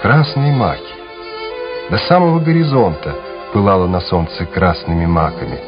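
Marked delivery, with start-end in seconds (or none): none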